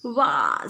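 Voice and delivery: a sudden, loud, spluttered wah; loud spluttering wah. A narrator's reading. Girl splashed with mud, spluttering a loud wah. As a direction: splutter it out, caught off guard. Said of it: "spluttered Nina".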